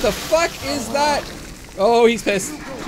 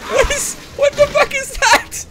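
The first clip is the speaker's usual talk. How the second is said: high, loud disbelieving cry; alarmed shout; 6 dB louder than usual. Real, not acted. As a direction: shout at the thing on screen. Sees a strange creature.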